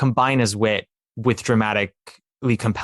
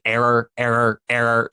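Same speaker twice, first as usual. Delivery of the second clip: glitching robot. Retold or imitated. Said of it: "like a robot glitching".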